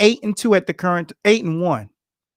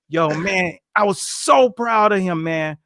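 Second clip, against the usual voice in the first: ordinary delivery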